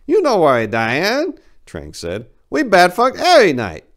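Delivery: high, loud broken English; high Trang voice. Acted. Voiced character Trang speaking.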